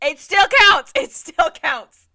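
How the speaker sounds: high, very loud yell; loud protest. Real, not acted. Insisting the sleepy "I love you" counts, loud yelling.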